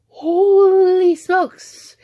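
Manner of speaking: loud, high, long-stretched outburst; exasperated exclamation. Real, not acted. Vlogger fed up with brother, high drawn-out exasperated exclamation. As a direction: draw out the first word high and loud.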